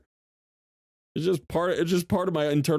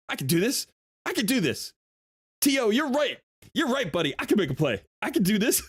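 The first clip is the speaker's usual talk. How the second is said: self pep talk; 7 semitones higher than usual. Retold or imitated. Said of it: "I'm just like".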